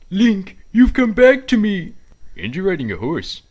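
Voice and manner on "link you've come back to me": higher, louder, slow swoon; swooning delight. Acted. Mayor swoons as Link comes back, loud gushing. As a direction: gush over your returned love.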